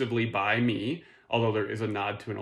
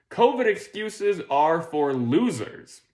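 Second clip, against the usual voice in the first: higher, slow mocking verdict; mock taunt; 6 semitones higher, 4 dB louder, slower than usual. Comedian quoting a business article, as a mock taunt. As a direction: jeer it slowly, pitched up.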